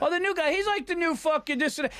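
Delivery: high-pitched mock chatter; mocking gossip. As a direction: imitate jealous comics carping about the newcomer. Mimics comics gossiping about newcomer.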